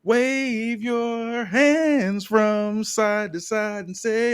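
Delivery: hyping up the arena crowd; yelled. Retold or imitated. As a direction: lead the crowd like a showman. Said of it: "Hendry yells".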